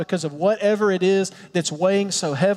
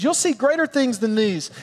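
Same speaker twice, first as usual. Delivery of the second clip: excited promise; 7 semitones higher than usual. Retold or imitated. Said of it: "Verse fifty says".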